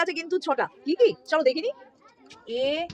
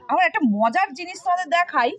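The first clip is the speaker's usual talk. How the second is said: ordinary delivery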